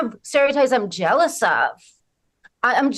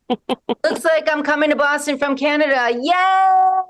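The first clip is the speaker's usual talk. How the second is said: higher, put-on giddy fan; excited fan voice; 5 semitones higher than usual. Reading a fan's message to the audience, giddy. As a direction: read it like a thrilled fan.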